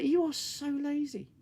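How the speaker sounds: high mocking jab at her; mock scold. Reviewer mocking the lounging captain, high sneering scold.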